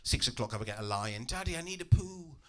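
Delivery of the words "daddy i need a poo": put-on small, soft child's voice; young daughter. Daughter waking her dad at six, small childlike plea. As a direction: play a little girl pleading softly. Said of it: "woken up at six by my daughter".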